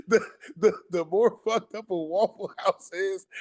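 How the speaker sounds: laughing through the words, pitch jumped up. Real, not acted. To his friend, cracking up at the Waffle House theory, laughing. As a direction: let the giggle carry the line.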